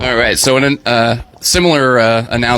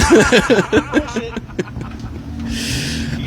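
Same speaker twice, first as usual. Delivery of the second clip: high laugh; 8 semitones higher, 4 dB quieter than usual. Real, not acted. Laughing at teasing.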